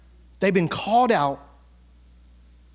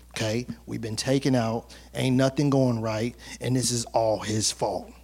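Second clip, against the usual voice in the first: much softer, low, plotting voice; conspiratorial Korah; 6 semitones lower, 5 dB quieter than usual. Acted. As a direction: hush the crowd and stir them up in secret. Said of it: "He said".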